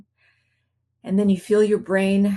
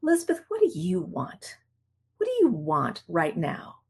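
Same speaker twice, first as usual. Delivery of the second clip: lightly raised, soft self-questioning; gentle self-talk; 2 semitones lower, 5 dB quieter than usual. Retold or imitated. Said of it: "So I find myself often saying".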